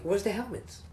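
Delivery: high mocking boss. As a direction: squeak it like a petty boss. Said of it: "he's like".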